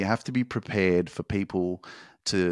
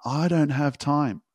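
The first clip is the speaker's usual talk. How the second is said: higher, slow whiny excuse; mock excuse; 6 semitones higher, slower than usual. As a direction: whine it like a lame excuse. Mocking listeners' excuse.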